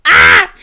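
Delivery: loud sudden yelp; sharp shriek. Real, not acted. Vlogger shrieking at the ma'am moment, loud yelp. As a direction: yelp out in disbelief.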